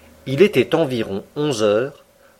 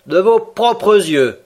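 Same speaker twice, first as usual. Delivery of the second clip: slow, higher disbelieving question; "De vos propres yeux" said slowly and higher, doubting; incredulous; 7 semitones higher, 4 dB louder, much slower than usual. A narrator's reading. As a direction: drag it out as if you can't believe it. Ned doubts Conseil's story.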